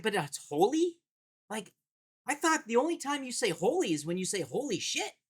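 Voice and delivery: put-on a five-year-old reasoning it out; mock child, innocently serious; imitating his daughter. Daughter quoted in a story.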